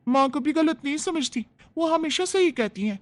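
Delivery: put-on young daughter-in-law. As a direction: primly take her side.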